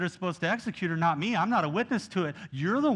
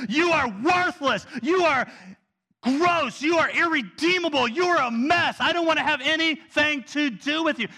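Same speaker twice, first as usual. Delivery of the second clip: much higher and louder, slowed, contemptuous; a loud mock voice of rejection; scornful condemning voice; 10 semitones higher, 7 dB louder than usual. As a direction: spit out the rejection with disgust. Imagined condemning God voice.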